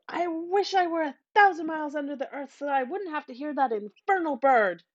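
a mother in anguish; wailing. A narrator's reading. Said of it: "The mother wailed".

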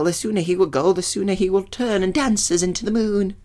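high, eager nymph voice. Acted. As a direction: play a smitten nymph, pitched high.

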